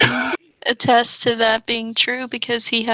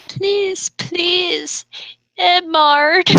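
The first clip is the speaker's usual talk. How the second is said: put-on higher, pleading like a lovesick girl; mock pleading cry; 5 semitones higher, much slower than usual. A narrator's reading. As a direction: beg him, whiny and hammed-up. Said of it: "She cried".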